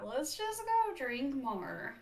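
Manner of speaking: put-on light Valley Girl lilt with rising end; a hint of Valley Girl, ending up high; Valley Girl accent. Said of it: "Why does it sound like Valley Girl?".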